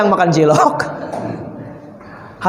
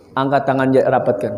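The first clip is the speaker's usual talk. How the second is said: ordinary talk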